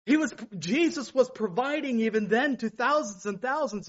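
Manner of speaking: full-voiced sermon delivery; fervent preaching. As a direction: preach it out to the congregation. Preaching with fervour.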